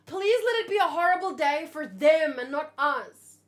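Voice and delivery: loud desperate plea; loud pleading. Real, not acted. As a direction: beg the story out loud. Pleading with the manga.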